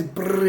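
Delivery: rolled brrr imitating gunfire; machine-gun noise. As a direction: make a rattling machine gun burst.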